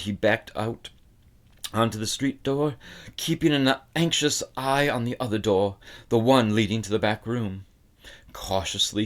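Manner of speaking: ordinary delivery, nothing marked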